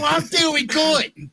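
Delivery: put-on high, loud, slow sing-song girly chirp; mocking the AI voice. Host mocking his AI girlfriend's cheery reply, high and slow. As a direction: ham up a sugary chatbot reply.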